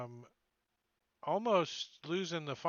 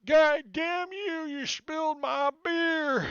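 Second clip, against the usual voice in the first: put-on much higher, louder, slow drunken roar; drunk thug yelling; 13 semitones higher, 9 dB louder, slower than usual. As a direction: bellow it at whoever spilled his beer. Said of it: "he's like".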